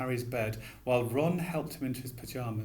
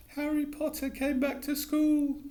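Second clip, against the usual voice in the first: high, slow squeaky elf voice, miserable; a tearful elf mourning; miserable house elf. A narrator's reading. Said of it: "he whispered miserably".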